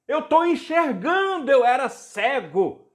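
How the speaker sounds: high, loud cry of a healed man; retold amazed shout. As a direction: act out someone shouting that they can see. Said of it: "ele começou a gritar". (grito de espanto, imitado, bem agudo)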